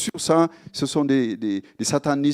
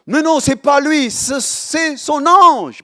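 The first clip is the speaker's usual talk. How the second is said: higher, louder exclamation; exclaiming disciples; 12 semitones higher, 10 dB louder, slower than usual. Retold or imitated. Disciples in Acts exclaim about Peter, higher and louder. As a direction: cry out that it's his angel. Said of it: "il dit".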